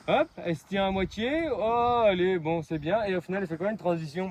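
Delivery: loud calls across the arena; calling out. Real, not acted. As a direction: shout it across the school. Coach calling out during jump.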